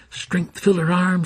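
ordinary delivery

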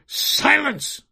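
high, loud enchanter's shout; shouted command. A narrator's reading. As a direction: shout the room into silence. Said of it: "shouted the enchanter".

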